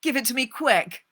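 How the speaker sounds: high, loud and hurried; snatch at the deal, high and loud; eager urgent demand. Acted. Della urges Madame to pay her quickly, high and loud. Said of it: "said Della".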